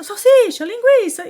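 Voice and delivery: high, loud courtside call-out; imitated coach's call. Opposing coach calls to players by food nicknames, high loud call.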